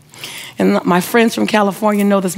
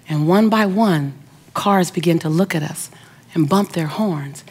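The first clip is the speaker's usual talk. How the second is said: ordinary talk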